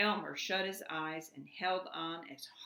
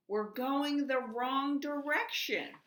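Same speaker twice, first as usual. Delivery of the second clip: higher, louder, slow shout over the storm; crying out over the storm; 8 semitones higher, 6 dB louder, slower than usual. A narrator's reading. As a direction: call it out against the wind. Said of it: "cried through the rumbling storm".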